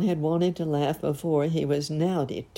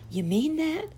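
high, rising disbelief; acted wide-eyed surprise; astonished; 13 semitones higher than usual. A narrator's reading. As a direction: ask it way up high, amazed. Clown astonished by the praise.

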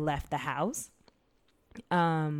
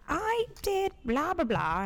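mocking mom; 13 semitones higher, 3 dB louder, slower than usual. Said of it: "my mom's gonna listen to this and be like".

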